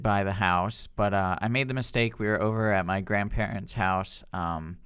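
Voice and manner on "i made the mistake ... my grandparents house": usual speaking voice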